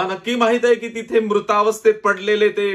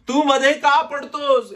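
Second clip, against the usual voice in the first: "तू मध्ये का पडतोस?" pitched higher and slower, with a stressed "का"; demon's cry; 5 semitones higher, 3 dB louder than usual. Retold or imitated. A preacher repeats the demon's challenge to his congregation, raised and slow. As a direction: slowly throw the possessed man's protest back at the room. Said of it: "याने काय म्हटलं?".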